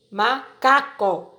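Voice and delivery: "macaco" drawn out slowly, ma-ca-co; slow syllable by syllable. Shown as a demonstration. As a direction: stretch "macaco" out slowly, each syllable apart. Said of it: "O nome macaco".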